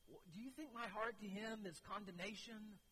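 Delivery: normal talking voice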